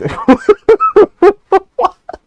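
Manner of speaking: bursting into a high, loud laugh. Real, not acted. Reacting to a ridiculous clip, a loud real laugh. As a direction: crack up loudly at the clip.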